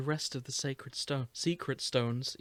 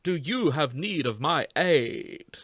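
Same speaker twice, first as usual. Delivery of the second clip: Matt Berry voice; 6 dB louder than usual. Acted. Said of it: "you're doing the Matt Berry for this one".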